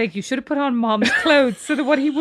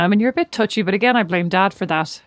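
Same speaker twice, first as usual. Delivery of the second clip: usual speaking voice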